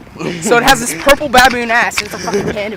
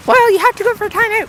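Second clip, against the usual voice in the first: high-pitched insistent mother impression; scolding mom; 10 semitones higher than usual. Mom insists on the timeout, high scolding voice.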